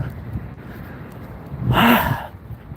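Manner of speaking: long, breathy exhale; heavy breathless sigh. Real, not acted. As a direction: blow out air after the climb. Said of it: "Catching my breath".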